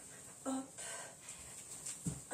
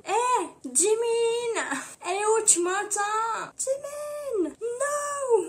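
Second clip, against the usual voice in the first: high, drawn-out wail; hammed-up high-pitched pleading cry; mock desperate calling; 9 semitones higher, much slower than usual. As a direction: call out as if the line is dropping. Acting calling on a dropped call.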